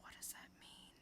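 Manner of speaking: hushed, mysterious whisper; spooky whisper. Acted. Reviewer whispers a cryptic question to the listeners. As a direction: whisper it like a riddle in the dark.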